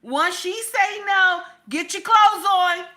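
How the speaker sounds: high, loud command to a son; mother's forceful warning. Acted. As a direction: hammer the rule home. Voices mother warning son about consent.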